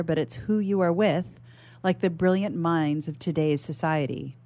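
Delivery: ordinary delivery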